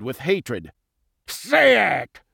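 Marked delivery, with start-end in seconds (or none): 1.3-2.2 higher, harsh villain yell; enraged villain demand; furious scream. A narrator's reading. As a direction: scream the order with hatred. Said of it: "he screamed".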